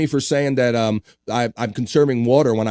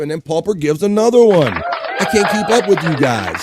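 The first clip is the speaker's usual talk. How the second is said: loud, high, overwhelmed cheer; excited amazement; 5 semitones higher, 4 dB louder than usual. Real, not acted. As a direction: shout it as the gifts pile up. Host reacts to another gift.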